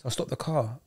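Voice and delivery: ordinary talk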